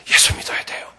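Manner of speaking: straining to shout but only a whisper comes; urgent plea with no voice; pleading to the grandmother with no voice; voiceless whisper. Retold or imitated. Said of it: "막 소리 지르고 전도를 했어요".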